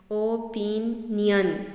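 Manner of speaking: Opinion in three slow beats, "o - pin - yun"; split into syllables. Shown as a demonstration. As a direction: break opinion apart, syllable by syllable. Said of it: "यहाँ पर three हैं". (Opinion को तीन हिस्सों में तोड़कर, धीरे)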